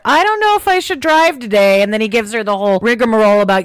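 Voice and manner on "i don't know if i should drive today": put-on high, hesitant teen voice; nervous Cordelia. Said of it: "she does tell the teacher".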